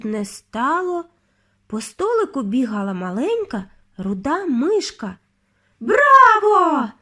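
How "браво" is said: high loud cheer; witches shrieking. A narrator's reading. Witches cheer the Grand High Witch, shrieking. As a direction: shriek bravo. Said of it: "заверещали відьми".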